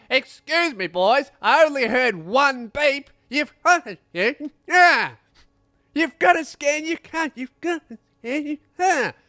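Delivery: high, loud, slow furious scolding; furious bus driver. Retold or imitated. Bus driver catching the boys who didn't scan, high loud fury. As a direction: lose your patience completely. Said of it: "And he goes".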